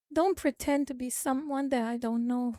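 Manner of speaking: ordinary talk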